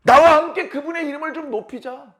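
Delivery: high, emphatic call to praise; rally friends to join in; acted earnest appeal; forceful urging. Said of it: "더 강하게 얘기를 합니다".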